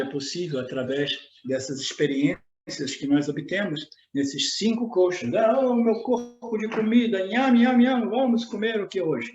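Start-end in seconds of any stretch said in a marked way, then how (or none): 5.3-9.3 playful, higher yummy voice; nom nom chomping. Pretend. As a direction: relish the food, lightly hammed. Playfully voicing the food body.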